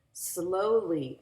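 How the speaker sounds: plain reading voice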